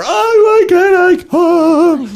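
put-on high, loud, slow wailing kid; mock tantrum wail from a heartbroken gymnast; child's tantrum. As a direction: wail it like a kid sprawled on the floor. Girl on floor after being told no.

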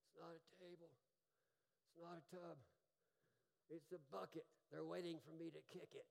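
a soft, small imitation of a sick wife; little cancer voice. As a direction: mimic her faint, worn-out voice. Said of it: "the little cancer voice from my wife".

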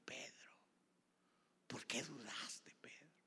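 very soft, half-whispered question; quiet, sorrow-tinged rebuke; hushed reproach. Acted. Jesus quietly reproaches Peter.

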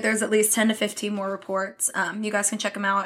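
usual speaking voice

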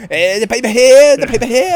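put-on loud, high paper boy call; newsboy yelling headlines. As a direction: shout it like an old newsboy. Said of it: "I sounded like a fucking paper boy".

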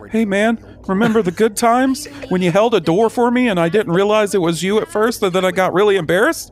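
high, gushing fanboy voice; hammed-up excited fan; mock fanboy message. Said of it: "and be like".

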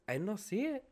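mock her puzzled reply; imitating coworker. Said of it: "She's like".

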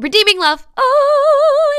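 sudden loud, high eureka; excited realization. Real, not acted. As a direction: blurt it out as it finally comes to you. Suddenly remembers the book title.

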